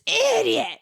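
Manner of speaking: high mock scream of a kid; retold kid scream. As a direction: shriek it like an angry child. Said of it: "he stops and he screams".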